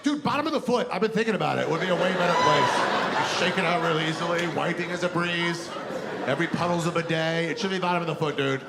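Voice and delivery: enthusiastic Adam. Acted. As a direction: sell the idea like a stoner bro. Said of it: "He's like".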